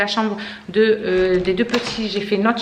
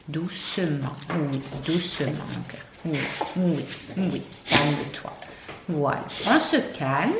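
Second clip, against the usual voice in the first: lower, soft, slow and calming; soothing; 6 semitones lower, 6 dB quieter than usual. Real, not acted. Mother calming her overexcited dog Félix with soft, slow soothing. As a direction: settle him down gently, almost hushing.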